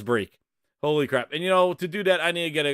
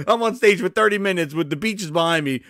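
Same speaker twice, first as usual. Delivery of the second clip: usual speaking voice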